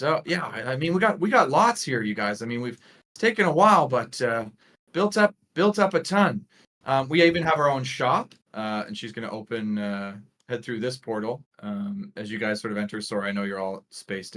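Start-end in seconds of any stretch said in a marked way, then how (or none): 0.0-8.3 lightly voiced guide, pitched up; hint of a proud host showing off; upbeat tour guide. Guide telling visitors what they built, upbeat. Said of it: "and continues, says".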